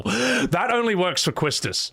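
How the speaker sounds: normal talking voice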